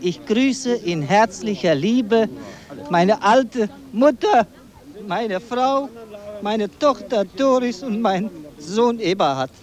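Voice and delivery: ordinary talk, nothing marked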